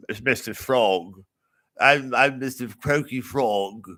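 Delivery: slow, croaky frog character voice. Acted. Riffing comic trying out another voice, slow croaky frog. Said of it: "I can't do other voices. What's this?".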